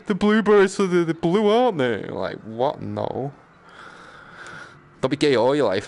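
ordinary talk

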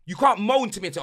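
ordinary delivery

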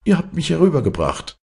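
hoch, schnell und triumphierend; froher Ruf. Acted. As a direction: triumphierend über den Fluss rufen. (hoch und schnell jubelnd)

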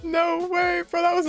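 high-pitched, thrilled disbelief; stunned disbelief. Real, not acted. Fan spotting a familiar place in the trailer, stunned. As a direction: react as if you can't believe it.

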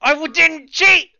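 high, loud protest; indignant yell. Real, not acted. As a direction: shout the denial. Denying cheating accusation.

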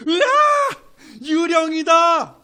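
high, loud, drawn-out ghost scream; hammed-up cry of panic at a ghost on the water; terrified shout. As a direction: scream like you've seen a ghost.

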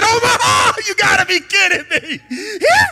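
a cry of disbelief thrown up at God; incredulous shout. Retold or imitated. Exclaiming at God's call.

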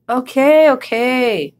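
a mild mock grumble; grumbling. A narrator's reading. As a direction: give in with a slight grumble. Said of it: "grumbled Yak".

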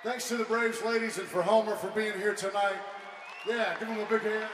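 high, loud emcee call; rally the crowd to applaud; announcing to the crowd. Real, not acted. Calling for applause for guests.

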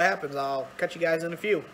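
talking around a cigar; words between cigar puffs; cigar in mouth. Real, not acted. Seen 0: Cigar held at lips from about 1.0 to 2.9s; face mostly out of frame afterwards.

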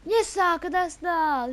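loud, high, slow yes; real joyful shout; drawn-out cheer. Real, not acted. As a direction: savor the relief of surviving. Team survives the round.

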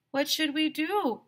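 high, slow worried question; anxious question. Acted. Trapped Arlo asking Mister Harrison what to do, high and slow. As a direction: ask for help, worried.

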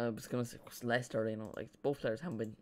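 ordinary talk, nothing marked

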